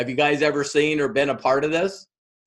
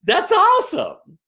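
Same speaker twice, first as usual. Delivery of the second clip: gushing praise; 2 semitones higher than usual. Retold or imitated. Friends congratulate Mark on his new business, gushing. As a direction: gush over his news. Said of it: "are like".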